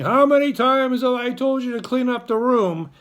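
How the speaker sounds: high, loud exasperated scolding; fed-up parent raising their voice; parent yelling. Shown as a demonstration. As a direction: yell at the kids to clean up. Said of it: "yell at your kids".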